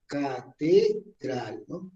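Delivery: Catedral slowly split as ca, te, dral; ca... te... dral, spaced out; syllable by syllable. Shown as a demonstration. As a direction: break Catedral into three separate beats. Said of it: "Fuerza de entonación".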